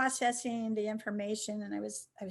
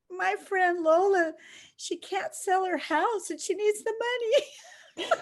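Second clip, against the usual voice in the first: mock crying; 6 semitones higher, 3 dB louder than usual. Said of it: "And I go".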